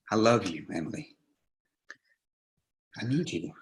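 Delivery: soft, low confession; tender. Acted. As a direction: say it gently to her.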